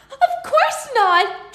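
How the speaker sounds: fairy offended by murder question; indignant fairy. Acted. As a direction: scoff at the idea.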